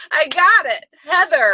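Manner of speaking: excited triumph. Real, not acted. Guest wins the guessing game.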